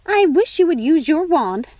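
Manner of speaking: a busy fairy godmother fussing over tea; fussy fairy godmother. Acted. Fairy godmother scolds sister.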